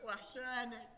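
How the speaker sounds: court each other gently, keeping it small; hushed tender flirting; shy sweet talk. Acted. Shy lovers exchange sweet words.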